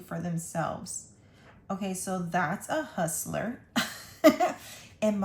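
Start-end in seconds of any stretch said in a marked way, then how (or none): 3.7-4.8 breathy laugh. Real, not acted. Laughs at own joke.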